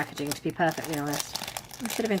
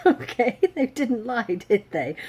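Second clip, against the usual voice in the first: ordinary talk, nothing marked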